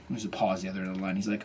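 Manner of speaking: usual speaking voice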